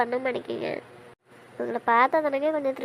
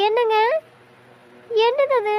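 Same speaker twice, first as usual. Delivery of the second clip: alarmed cry; 7 semitones higher, 6 dB louder than usual. Acted. Wife sees bandaged husband on video.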